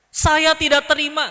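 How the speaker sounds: loud, high outburst of protest; indignant refusal. Acted. Man hit by bird droppings refuses to accept it, indignant shout. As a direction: protest it loudly, pitch jumping up.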